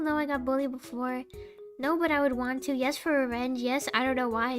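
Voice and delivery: ordinary delivery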